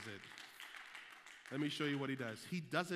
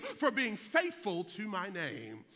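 higher, louder, half-sung preaching whoop; sermon whoop; 6 semitones higher, 5 dB louder, slower than usual. Acted. Pastor whooping Jesus's thanks to the faithful, high and sung. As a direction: sing the promises out over the organ.

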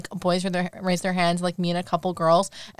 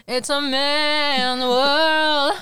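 higher, louder, dragged out very slowly; mock slow quote; 6 semitones higher, 4 dB louder, much slower than usual. Quoting the movie's dumb bro line, slow and mocking. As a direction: stretch each word like a cartoon bro. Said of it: "He's saying".

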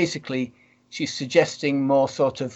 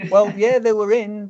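laughing; 6 semitones higher, 3 dB louder than usual. Real, not acted. Laughing at lizard-eyes joke.

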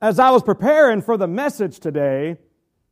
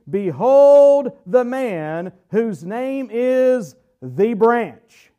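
Behold stretched out slow and louder; slow proclamation; 2 semitones higher, 5 dB louder, much slower than usual. Acted. Preacher reading Zechariah to the class, slow and loud. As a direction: announce it like a herald. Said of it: "reads Zechariah emphatically".

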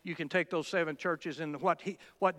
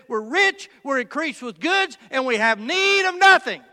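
loud, high, impassioned preacher's cry; preaching at full force; 7 semitones higher, 6 dB louder than usual. Acted. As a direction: pound it out to the congregation with rising fervour.

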